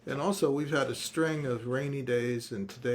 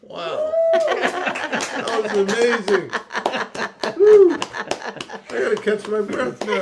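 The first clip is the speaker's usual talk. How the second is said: excited; 11 semitones higher, 15 dB louder, slower than usual. Real, not acted. Amazed reaction after song.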